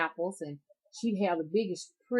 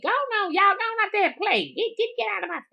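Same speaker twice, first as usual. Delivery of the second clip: put-on high and loud old granny shooing kids; granny's high-pitched voice; 8 dB louder than usual. Granny shooing the grandkids outside, high-pitched and loud. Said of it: "high, high pitched voice".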